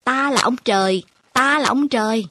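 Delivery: put-on playful child boasting from a cow's back; shouting. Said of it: "mà hét".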